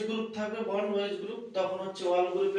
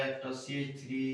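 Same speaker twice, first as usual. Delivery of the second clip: normal talking voice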